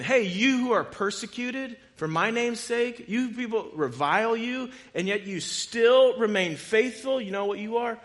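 loud, high, impassioned; voicing Jesus. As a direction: proclaim it like Jesus himself speaking. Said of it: "He's saying".

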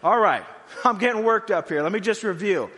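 loud, high, laughing at being worked up; worked-up inner voice. Retold or imitated. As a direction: talk yourself down while still heated. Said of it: "we need to, like".

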